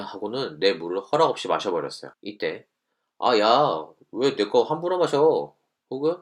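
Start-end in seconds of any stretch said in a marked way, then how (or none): 3.2-5.5 louder, higher, slow annoyed snap; angry retort. Shown as a demonstration. Student snapping at a friend who drank their water, mock angry. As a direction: push back at the friend, irritated. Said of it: "이러면 싸움이 되죠".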